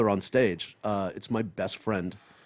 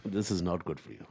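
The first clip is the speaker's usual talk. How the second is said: put-on lower, softer British Indian accent; 3 semitones lower, 5 dB quieter than usual. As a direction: redo mom's line in her British Indian accent. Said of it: "It's not how she said it".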